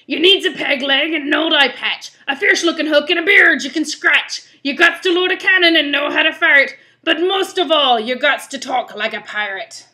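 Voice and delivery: rally the crew as a rough pirate. Acted. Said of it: "It's hard doing this pirate voice".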